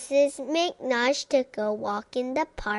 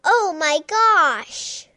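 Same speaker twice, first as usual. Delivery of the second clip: dramatic exclamation; 4 semitones higher, 6 dB louder than usual. A narrator's reading. Refrain exclamation.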